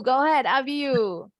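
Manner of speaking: normal talking voice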